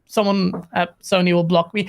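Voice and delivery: ordinary delivery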